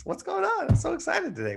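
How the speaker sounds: very high-pitched, bubbly excitement; upbeat. Shown as a demonstration. Trainer showing how to address blues, excited and upbeat. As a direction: gush with a demonstrative, excited squeal of a voice. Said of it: "it's usually fast and I'm upbeat".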